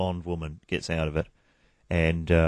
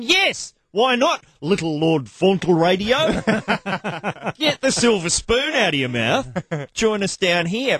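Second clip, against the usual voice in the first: much higher, jeering commuter voice; mocking commuters; 11 semitones higher than usual. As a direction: taunt the posh radio guy. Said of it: "people who use public transport are thinking".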